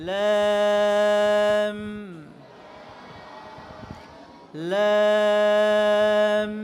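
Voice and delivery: long "লাম" with fronted vowel, lips pressed on final m; drawn-out model "লাম", closing firmly on m; thin lam. Shown as a demonstration. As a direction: stretch each "লাম" light and level into a hum. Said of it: "চাপ দিতে হবে".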